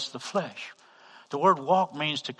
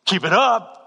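loud, higher mock threat; imitated angry retort; 6 semitones higher, 8 dB louder than usual. Shopper warns the preacher in line, mock angry retort. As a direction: snap it like a man spoiling for a fight.

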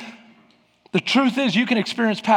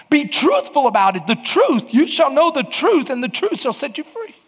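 raised, ringing sermon voice; full-force preaching; 5 semitones higher than usual. Acted. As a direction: preach it out with fire.

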